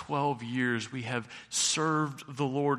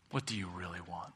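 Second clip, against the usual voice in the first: softer, inward "What do you really want?"; quiet question; 5 semitones lower, 8 dB quieter than usual. Shown as a demonstration. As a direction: ask "What do you really want?" gently, almost to yourself. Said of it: "Not what do you think you should want".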